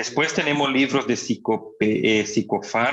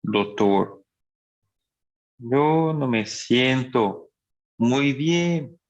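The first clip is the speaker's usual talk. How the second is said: slow, dragging patient speech; a weary patient speaking very slowly; slow patient talk; much slower than usual. Shown as a demonstration. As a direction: drag each word out like a slowed patient. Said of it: "el paciente habla muy despacio".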